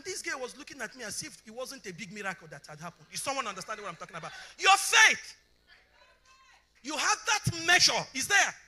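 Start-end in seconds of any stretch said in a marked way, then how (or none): 4.6-8.5 loud, high preaching build; preaching at full force. Acted. Pastor preaching loudly to the congregation.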